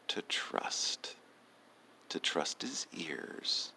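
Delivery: soft unvoiced bedtime whisper. A narrator's reading. Storyteller whispering to sleepy children, a soft whisper. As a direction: hush it down to a breath.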